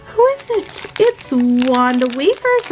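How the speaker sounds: thrilled surprise at the reveal; excited gasp. Real, not acted. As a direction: gasp and ask in delight. Revealing a new toy.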